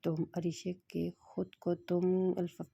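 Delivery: plain reading voice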